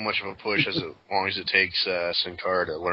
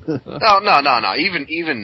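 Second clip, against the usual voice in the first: loud emphatic denial; loud objection; 7 semitones higher, 8 dB louder than usual. Real, not acted. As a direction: shut it down firmly. Heated objection.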